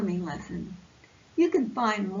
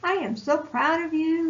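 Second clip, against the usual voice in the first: proud and excited; 6 semitones higher, 5 dB louder, faster than usual. Acted. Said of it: "arm around his friend's shoulders".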